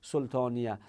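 سلطانیه ending in "-iyah"; fatha ending. Shown as a demonstration. Teacher gives students the preferred form after the ugly ones, fatha ending. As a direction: say سلطانیه with an open a before the final h. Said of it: "زشته اصلاً تلفظش".

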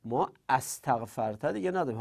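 ordinary talk, nothing marked

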